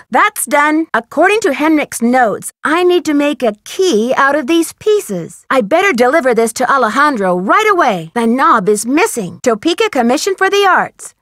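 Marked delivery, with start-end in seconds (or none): none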